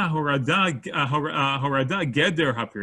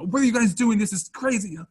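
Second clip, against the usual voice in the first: indignant protest; 7 semitones higher than usual. Retold or imitated. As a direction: struggle against them, outraged. Held down by friends, protesting.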